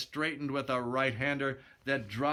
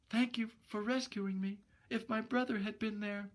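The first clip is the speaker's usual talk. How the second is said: put-on high, soft girlish voice; grateful young woman; 10 semitones higher, 3 dB quieter than usual. Gloria thanking Steve on the dark street, high soft voice. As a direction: thank him sweetly.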